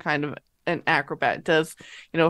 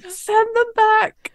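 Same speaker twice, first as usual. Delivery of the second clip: high, slow, breathy; 4 dB louder, slower than usual. Acted. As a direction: breathe it out thinly. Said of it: "in a very breathy voice".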